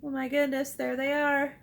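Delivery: normal talking voice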